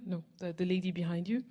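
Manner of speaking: ordinary talk, nothing marked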